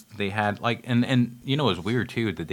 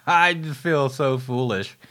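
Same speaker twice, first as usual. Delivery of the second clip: embarrassed laugh through the words, higher and louder; laughing at himself; 7 semitones higher, 5 dB louder, slower than usual. Real, not acted. Reviewer laughing at his own blunder to viewers, sheepish laugh. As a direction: laugh it off, caught out by your own mistake.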